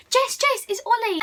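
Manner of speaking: urgent excited call to a friend. Retold or imitated. Fan spots Ollie and calls to Jess, excited. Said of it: "and I was like".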